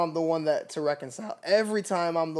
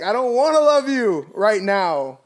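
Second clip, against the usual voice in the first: louder, slow, sulky refusal; stubborn pride; 6 semitones higher, 8 dB louder than usual. Pretend. Voicing pride that refuses to love, slow and sulky. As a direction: dig in your heels.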